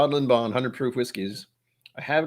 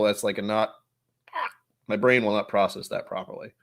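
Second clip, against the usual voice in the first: normal talking voice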